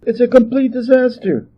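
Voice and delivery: put-on high dismayed old queen voice; an aghast royal lady, pitched high; crying queen mother. A narrator's reading. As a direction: wail it as an upset queen. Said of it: "cried the Lady Queen Mother".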